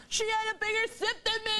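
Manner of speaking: put-on high indignant child's whine; high child tattling; whiny child. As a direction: complain like a jealous little kid. Children fighting over juice.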